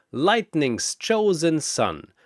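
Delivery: plain reading voice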